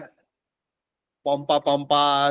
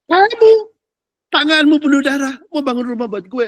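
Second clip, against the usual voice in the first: high, loud booming voice of God; 7 semitones higher, 5 dB louder than usual. Retold or imitated. God rebuking David over his bloody hands, booming high and loud. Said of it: "Tuhan bilang".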